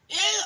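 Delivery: high, loud squeal of disgust. Acted. Reading to a child, milk all over the piglet, squealing.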